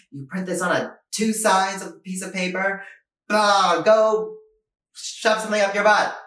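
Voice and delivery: high, loud mock yell at employees; mock boss tirade. Parents yelling insults at their employees, mock angry shout. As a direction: bark like an angry old-school boss.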